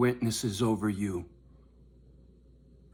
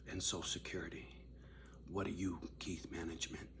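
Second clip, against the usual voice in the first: whisper; 6 semitones lower, 16 dB quieter, faster than usual. Real, not acted. Whispered taunt.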